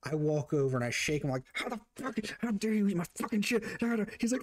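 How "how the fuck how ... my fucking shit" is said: high-pitched outraged rant, acted out; comic retold indignation; mock angry. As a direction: replay a furious confrontation in a high voice. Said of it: "I was so angry".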